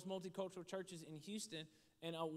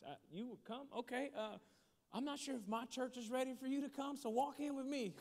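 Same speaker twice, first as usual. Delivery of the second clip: higher-pitched nervous surprise; flustered; 6 semitones higher than usual. Retold or imitated. Pastor worried his church isn't ready for her, flustered. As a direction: stumble into a hasty, protective offer. Flustered reply to waitress's acceptance.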